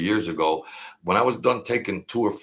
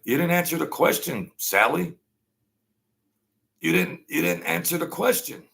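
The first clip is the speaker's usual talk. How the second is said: higher, insistent retelling of a senator; Ted Cruz pressing; 8 semitones higher than usual. Retold or imitated. Retelling Ted Cruz grilling a witness, insistent and higher. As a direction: push hard for an answer, raised pitch. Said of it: "And Ted Cruz is like".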